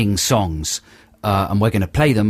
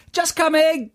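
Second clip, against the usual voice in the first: high, long-drawn mock-posh answer; posh reply; slower than usual. Guys replying to the shout in a high mock-posh voice. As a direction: chime in like a toff.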